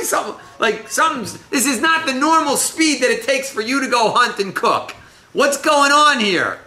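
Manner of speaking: high, tumbling, incredulous questions; baffled questioning. Acted. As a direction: pile up the suspicious questions at high pitch. Acts out baffled Isaac questioning speed.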